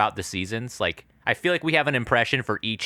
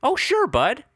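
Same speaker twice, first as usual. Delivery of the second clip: Midwestern lilt, pitched way up; Midwestern accent. Retold or imitated. Copying the actor for friends in a high Midwestern accent. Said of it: "it's more Midwestern".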